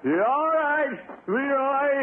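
high, loud eager shout; calling for the pass. Acted. Gildersleeve yelling to Leroy for the pass, high loud call. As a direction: yell that you're ready for the throw.

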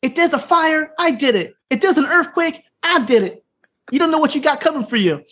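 high, hammed-up heel promo; mock heel yell. Heel yelling at the crowd, mock high rant. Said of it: "He starts yelling at the crowd".

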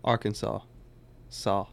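"Arkansas" as AR-kun-saw, then "Saw" alone; silent final S. Shown as a demonstration. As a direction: model the ending "Saw" of "Arkansas" without S. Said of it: "The S would be silent".

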